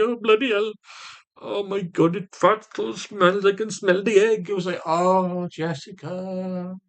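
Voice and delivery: high, dragged-out dismay; mock disgust. Man reacting to the smell of her fart, mock disgust. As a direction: wail it in hammed-up disgust.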